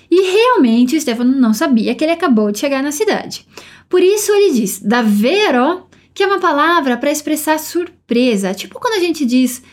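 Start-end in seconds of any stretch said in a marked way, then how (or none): 4.8-5.9 Davvero said slowly with a held double vv; double v stressed. Shown as a demonstration. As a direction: lean on the vv in Davvero. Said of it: "essa palavra tem dois Vs".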